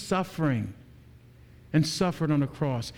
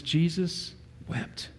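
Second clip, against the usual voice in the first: slow and much softer, letting it land; hushed solemn reading; 6 dB quieter, much slower than usual. Acted. Preacher reading the verse to the congregation, hushed and slow. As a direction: drop the voice and read it very slowly. Quoting the shortest Bible verse.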